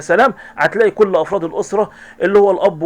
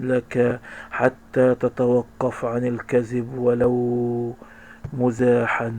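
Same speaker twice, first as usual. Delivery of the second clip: tender relief; 7 semitones lower, 8 dB quieter, much slower than usual. Acted. Parents gently relieved.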